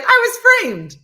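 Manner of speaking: high, loud whiny protest of innocence; mock protest. Jim denying he tripped her, in a loud mock whine. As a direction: whine it like a cartoon crook caught red-handed. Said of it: "Jim's like".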